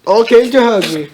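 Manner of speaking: ordinary delivery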